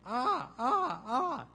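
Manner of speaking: very high, loud zombie cries; high imitated undead "ah" calls; zombie caw. Shown as a demonstration. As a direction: yelp it as a zombie. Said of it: "as a zombie would say".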